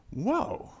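a high, hushed gasp of surprise; mock surprised whoa. Dinner guests reacting as the sinful woman walks in, mock surprised. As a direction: act out the guests' shocked reaction. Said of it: "I think they go".

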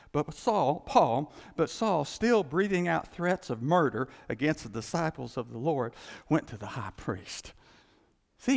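ordinary talk, nothing marked

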